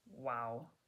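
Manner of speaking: low, hushed awestruck wow; awed wow. Acted. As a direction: breathe it out, lost for words. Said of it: "my language capacity was instantly reduced to one word".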